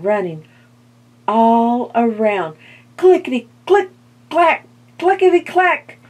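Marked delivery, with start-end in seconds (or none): 3.0-6.0 frantic. A narrator's reading. Skeleton running in fright.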